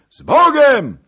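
high, very loud drawn call; shouted farewell. A narrator's reading. Departing rider bids Zagloba farewell, high shout. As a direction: holler goodbye from afar.

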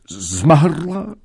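high, loud horrified shout; shocked cry. Acted. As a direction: cry out in disbelief. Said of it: "krzyknął Kmicic".

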